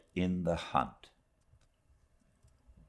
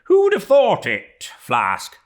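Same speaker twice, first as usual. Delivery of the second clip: high, loud sailor's cry; crying out as Stubb; 13 semitones higher, 10 dB louder than usual. A narrator's reading. Stubb calls out to Flask, a high loud cry. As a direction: Stubb hails his shipmate, pitched up and loud. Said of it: "cried Stubb".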